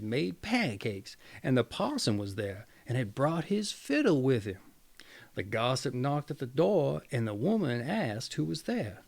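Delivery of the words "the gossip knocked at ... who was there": ordinary delivery, nothing marked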